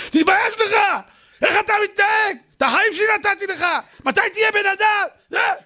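high shrieking telling-off; screeching scold. Acted. Woman on the stairs screaming at her dog, high and shrill. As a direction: scream at the dog like a furious mother.

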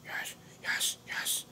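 unvoiced whispered yeses. Real, not acted. Quietly agreeing with himself in a whisper.

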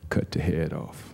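low, slow, soft deadpan; hushed, deep, deliberate instruction; wife's grim command. Retold or imitated. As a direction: give the order with ominous calm. Wife's grim order, deadpan contrast.

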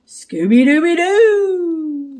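high, loud, slow howled catchphrase; long triumphant doggy howl; Scooby-Doo. A narrator's reading. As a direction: howl it out like a cartoon dog. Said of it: "Scooby howled".

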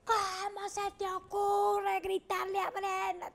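mock boss scolding, high and slow; imitated scolding. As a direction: bark it like an angry boss, hammed-up. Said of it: "Me grita Mauricio". (regaño imitado del jefe, alto y lento)

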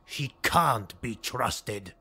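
lightly voiced Highborn, slow; slow flat certainty. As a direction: say it slowly, sure of it. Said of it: "he said".

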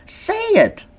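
high, loud insistent push; pushing him to confess; high-pitched urgent prod; urgent demand. Retold or imitated. Her demand to husband, retold.

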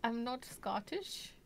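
put-on slow, muddled Scottish-British accent; would-be Scottish accent. Shopkeeper denying being Scottish, in a put-on Scottish accent. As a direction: deny it slowly in a dubious Scottish accent. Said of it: "like, Scottish".

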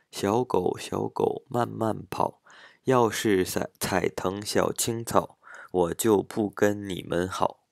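slow, rhythmic Mandarin recitation with clear tones; Chinese rhyme reading. Shown as a demonstration. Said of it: "ちょっと読んでみましょうか". (中国語の童謡をゆっくりリズムよく朗読)

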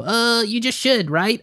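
lightly mocked client shrug; unsure client. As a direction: play the client shrugging it off, slow. Mimicking client's weak reply.

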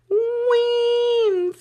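high sustained winner call; dramatic announcement. Real, not acted. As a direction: stretch the win into a big reveal.

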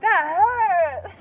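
loud, high cry of pain; pained yelp. Real, not acted. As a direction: yelp it out, stretched and indignant at the hurt. Pained reaction to a shock.

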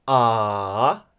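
AH dipping low, then rising; long, scooped AH. Shown as a demonstration. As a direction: let AH sink low and climb back up. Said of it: "we can call it a dipping tone".

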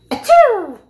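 loud high pretend sneeze; hammed-up achoo for the dog; fake sneeze.

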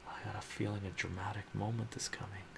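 quick hushed aside; rushed excited whisper; fast whisper. Real, not acted.

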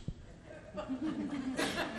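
a short, high, airy laugh at himself; rueful high chuckle; high breathless laugh. Real, not acted. Laughs at own remark.

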